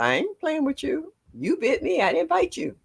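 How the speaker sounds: high-pitched, insistent retold telling-off; indignant scolding. Nana to the staring child who bit her, indignant. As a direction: press the point, voice lifted high.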